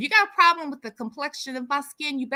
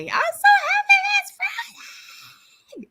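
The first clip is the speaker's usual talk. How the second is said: much higher and louder, laughing, slow; gleeful cry; 5 dB louder, slower than usual. Real, not acted. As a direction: squeal it out with a laugh, slowly. Celebrating Friday.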